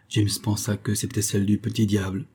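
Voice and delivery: ordinary delivery, nothing marked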